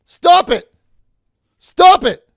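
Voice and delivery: high-pitched, forceful shouting to cut someone off; shouted interruption. Real, not acted. Host cutting off an abusive caller's threats, shouted. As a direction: shout high and very loud, over and over.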